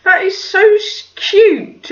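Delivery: high-pitched gushing; re-enacted doting coo; melting at cuteness.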